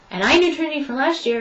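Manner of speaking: ordinary delivery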